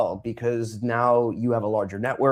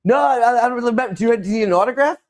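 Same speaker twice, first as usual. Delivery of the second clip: much higher, rushing to the autograph bit; mock flustered; 11 semitones higher, 4 dB louder, faster than usual. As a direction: hurry it out high and awkward. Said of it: "And I'm like".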